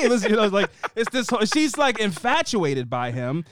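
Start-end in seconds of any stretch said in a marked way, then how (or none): none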